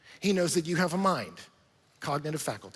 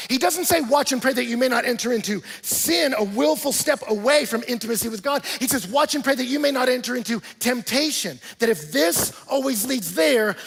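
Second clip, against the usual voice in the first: loud, driving sermon delivery, rising higher; fired-up preaching; 7 semitones higher, 9 dB louder than usual. Acted. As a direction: preach it out to the whole room. Preaching at full force.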